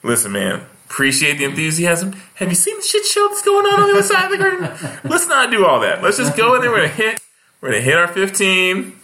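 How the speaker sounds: high, fast, laughing exasperation; exasperated host. Retold or imitated. Host begs the feature comic to keep it short, laughing high. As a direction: talk him down, half laughing. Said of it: "And I was like".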